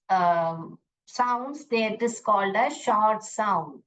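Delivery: every word slowed right down for the class; slow repeat. Shown as a demonstration. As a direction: repeat it slowly so they follow. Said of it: "I'll repeat once again". (कक्षा के लिए बहुत धीरे दोहराना)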